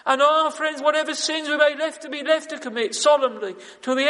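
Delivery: preacher urging the congregation about daily mercy, impassioned; impassioned preaching. Real, not acted. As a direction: build the plea with mounting fervour. Preaching at rising force.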